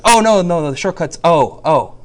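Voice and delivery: hammed-up "no, no" to a student; retold correction. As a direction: hurry to correct the student, playfully exaggerated. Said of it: "So I was like".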